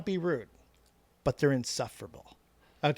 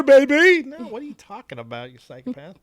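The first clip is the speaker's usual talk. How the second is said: incredulous outburst; 7 semitones higher, 10 dB louder than usual. Real, not acted. Mock scolding co-host.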